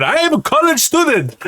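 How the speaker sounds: retold scream. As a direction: imitate a panicked student yelling. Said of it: "he just kept screaming".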